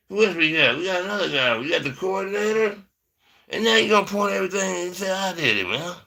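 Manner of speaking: hammed-up wounded denial, words held long; protesting innocence. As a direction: plead your innocence in a high, stretched voice. Acted denial in cookie quarrel.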